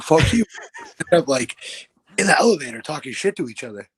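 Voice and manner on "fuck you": loud mock retort, fuck you thrown back; retorting friend. Friend firing back in the elevator, mock yell. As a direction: shout it back at him. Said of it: "And he's like".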